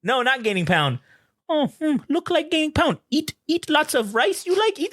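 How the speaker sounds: high-pitched mock Korean accent; imitated broken-English Korean parents. Girlfriend's Korean parents to boyfriend about weight, high mock accent. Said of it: "they didn't speak English that well".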